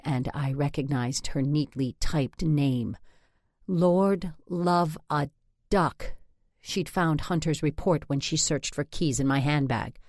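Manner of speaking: plain reading voice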